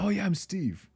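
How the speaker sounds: put-on high, soft Miami mafioso accent greeting. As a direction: play Steve with a Miami mob accent. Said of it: "he talks in sort of this mafioso Miami accent".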